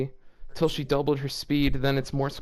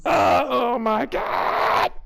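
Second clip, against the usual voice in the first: shocked outcry; 9 semitones higher, 4 dB louder, much slower than usual. Real, not acted. Shocked by what he reads.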